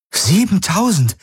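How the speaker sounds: hoch hinaufschnellend vor Staunen; ungläubiger Ausruf. Acted. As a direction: kaum fassen, was man hört. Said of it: "rief Kirai aus". (Kirai ruft verblüfft über die Messung aus)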